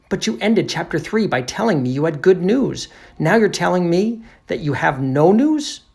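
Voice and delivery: high, loud, incredulous questioning; baffled narrator. Acted. Narrator challenges the detective about news, incredulous. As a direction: build to a disbelieving question.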